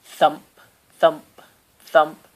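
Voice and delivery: plain reading voice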